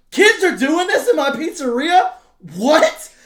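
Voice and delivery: high, loud incredulous squeal; stunned shriek at the screen; shocked disbelief. Retold or imitated. Retold disbelief at the game.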